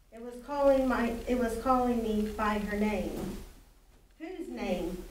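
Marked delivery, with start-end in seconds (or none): none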